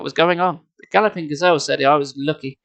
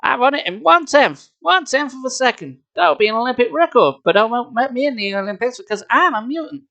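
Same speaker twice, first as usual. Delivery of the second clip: bragging superhero; 7 semitones higher than usual. A narrator's reading. Superhero brags to the kid, high and cocky. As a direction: brag like a vain superhero.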